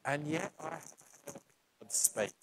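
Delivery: sincere and hushed; soft sincere testimony. Real, not acted. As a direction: speak it softly like a secret truth. Intimate personal testimony.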